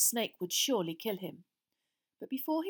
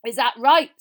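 very loud, higher rough retort; 4 semitones higher, 17 dB louder than usual. A narrator's reading. Frank answering Lord Voldemort's warning, rough and loud. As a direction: throw it back at him roughly. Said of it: "said Frank roughly".

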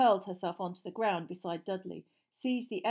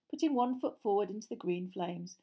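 ordinary delivery, nothing marked

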